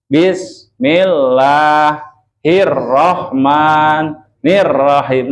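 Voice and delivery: very slow Bismillahirrahmanirrahim with a pause after bis; slow chanted wrong reading; wrong recitation demo. Shown as a demonstration. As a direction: stretch it out, stopping wrongly mid-word. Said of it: "itu mah kamu murang kalih".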